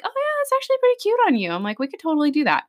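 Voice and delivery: fake enthusiasm. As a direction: gush brightly to please the client. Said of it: "And I'm like".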